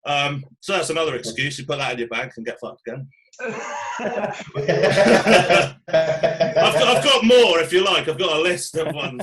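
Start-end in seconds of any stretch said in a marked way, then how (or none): 3.4-7.6 laughing out the words. Real, not acted. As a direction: laugh through it. Laughing while offering more.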